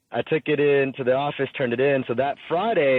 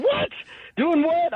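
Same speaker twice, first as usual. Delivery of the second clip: surprised; slower than usual. Retold or imitated. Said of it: "And I'm like".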